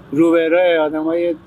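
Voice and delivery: ordinary talk, nothing marked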